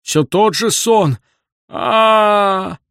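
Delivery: high, loud, drawn-out wail; operatic lament. A narrator's reading. Old man bewails the same dream, operatic high wail. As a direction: lament like Boris Godunov on stage. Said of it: "с шаляпинскими интонациями в голосе".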